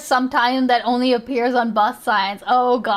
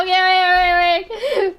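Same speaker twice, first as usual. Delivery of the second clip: laughing; 6 semitones higher, much slower than usual. Real, not acted. Laughing fit after the clip.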